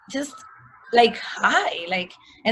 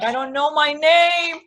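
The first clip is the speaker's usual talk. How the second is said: a higher, louder, distressed plea; panicked cry; 6 semitones higher, 6 dB louder than usual. Retold or imitated. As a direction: cry out in panic, lost and scared. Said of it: "I started crying in panic".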